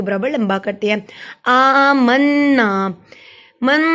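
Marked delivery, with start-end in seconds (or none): 1.4-2.7 "آمنا" slow, long aa and humming nn; ghunna. Shown as a demonstration. Teacher showing ghunna, "آمنا" with a held nasal n. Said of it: "پہ گنہ کیا". 3.6-3.8 "من" with a long nasal hum on n; ghunna. Shown as a demonstration. Teacher isolating the ghunna, "من" held on a nasal n. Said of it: "پہ گنہ کیا".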